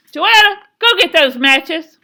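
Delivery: high, very loud command; furious mother's shout. A narrator's reading. Mama demanding matches from Joetta, furious shout. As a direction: yell the order at your child.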